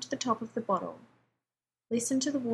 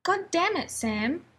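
higher, exasperated narrated yell; raised-voice outburst, acted within narration; startled yell; 8 semitones higher, 3 dB louder than usual. A narrator's reading. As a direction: cry out in angry alarm, briefly. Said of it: "he'd heard himself yell".

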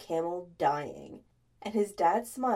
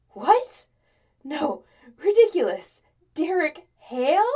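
loud, high, flustered disbelief; incredulous denial; 7 semitones higher, 10 dB louder than usual. A narrator's reading. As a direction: protest as if it's absurd. Acted flustered denial to his dad.

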